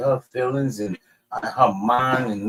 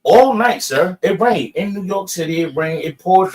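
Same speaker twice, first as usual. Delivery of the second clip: insistent; 5 semitones higher, 4 dB louder than usual. Real, not acted. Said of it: "insisting on the rain".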